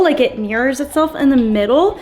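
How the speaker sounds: ordinary talk, nothing marked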